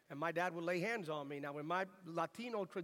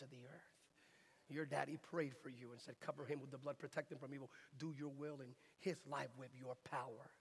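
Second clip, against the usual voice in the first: imitated voice of God; 5 semitones lower, 5 dB quieter than usual. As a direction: speak a hushed divine assurance, weighing each phrase. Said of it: "And I heard a voice".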